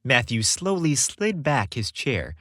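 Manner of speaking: ordinary delivery, nothing marked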